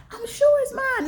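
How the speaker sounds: mock high-pitched rival claiming the car; insistent claimant. Stranger insisting to the owner the car is theirs, high mock insistence. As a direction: put on a high voice, staking a claim. Acted dispute over a car.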